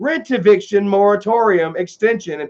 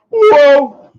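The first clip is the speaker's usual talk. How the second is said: loud, high drawn-out whoa; Joey Lawrence whoa; 6 semitones higher, 9 dB louder than usual. Retold or imitated. Streamer doing the sitcom star's catchphrase again, loud and high. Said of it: "I busted out old Joey fucking Lawrence".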